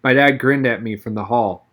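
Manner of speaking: usual speaking voice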